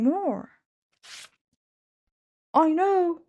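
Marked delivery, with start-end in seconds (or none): 2.5-3.2 high, loud burst of an idea; excited cry. A narrator's reading. Max finds a plan, loud high excited cry. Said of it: "cried Max".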